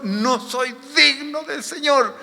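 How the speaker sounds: high, slow cry of unworthiness; pensamiento de Pedro. Acted. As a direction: draw it out high, as an anguished confession. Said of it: "Pedro en este momento pensaba". (confesión de indignidad, aguda y alargada)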